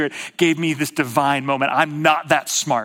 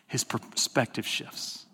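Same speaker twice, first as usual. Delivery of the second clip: hushed; 5 semitones lower, 12 dB quieter, slower than usual. Real, not acted. Preacher drops to hushed climax.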